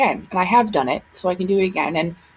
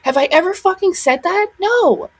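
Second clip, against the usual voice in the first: angry retort; 10 semitones higher than usual. Real, not acted. Ranting at rude commenters.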